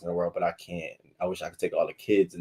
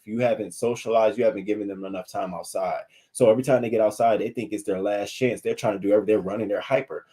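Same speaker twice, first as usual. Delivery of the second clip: usual speaking voice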